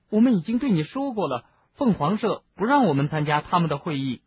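high, anxious explanation; uneasy, slightly defensive protest. A narrator's reading. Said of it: "赫敏不安地说".

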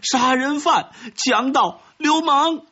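high-pitched howled insults; howling. A narrator's reading. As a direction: hurl each accusation out in a high yell. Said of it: "吉吉诺跟着嚎叫起来". (尖声嚎叫着咒骂)

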